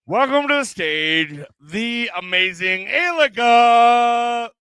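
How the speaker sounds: big, slow build to the performer's name; loud emcee call, stretching the name high; stage announcer. Real, not acted. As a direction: announce the act with a drawn-out flourish. Host introduces next performer.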